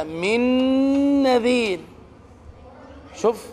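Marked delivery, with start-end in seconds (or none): 0.0-1.8 ن of من merged into نذير with a held nasal hum; merged nun, idgham. Shown as a demonstration. Teacher contrasting idgham with izhar, held nasal merge. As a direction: run من into نذير, doubled nasal ن. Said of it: "لأن الإدغام هكذا".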